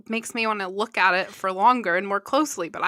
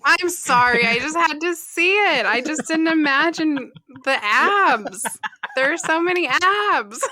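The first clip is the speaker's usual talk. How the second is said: loud, high, giddy gushing; excited; 8 semitones higher, 6 dB louder than usual. Real, not acted. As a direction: squeal it over the photo. Gushing over photo of abs.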